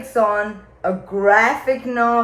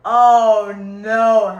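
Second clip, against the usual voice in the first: loud wailing Oh no; loud, half-laughing cry of dismay; mock dismay; 8 dB louder, much slower than usual. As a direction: wail it in despair. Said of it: "I was like".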